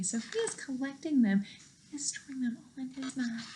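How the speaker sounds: fast secret whisper. Acted. Teacher sharing a secret with the class, rapid whisper. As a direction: let it slip out as a hushed secret.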